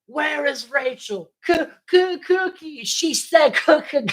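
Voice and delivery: high stammer on "cookie"; imitated stutter. Mimicking Jamie trying to say "cookie", high stutter.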